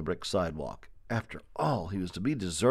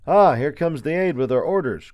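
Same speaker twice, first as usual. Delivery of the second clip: higher, louder call to comrades; soldier's cry; 6 semitones higher, 7 dB louder than usual. A narrator's reading. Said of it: "cried one of the unseen soldiers".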